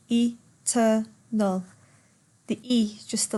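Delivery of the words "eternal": "Eternal" slowly split e-ter-nal; tiga suku kata. Shown as a demonstration. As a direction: give "Eternal" three separate beats. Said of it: "eternal has three syllables".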